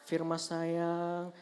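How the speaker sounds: slow, soft, tender coaxing; gentle loving dad. Retold or imitated. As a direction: coo it like a doting father waking his son. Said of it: "lemah lembut, penuh cinta dan kasih sayang".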